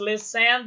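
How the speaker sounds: "listen" stressed on its second syllable, lis-TEN. Shown as a demonstration. Teacher imagining listen stressed on the end, lis-TEN. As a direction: put the weight on "ten" in "listen". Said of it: "duplicava o N".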